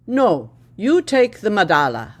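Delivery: intervening. A narrator's reading. Friend cuts in to change the plan, loud and firm. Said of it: "Resahatli intervened".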